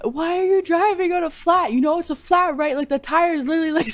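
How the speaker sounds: high, urgent, contained shouting; retold screaming. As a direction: imitate someone calling out in alarmed disbelief. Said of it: "running out screaming at her".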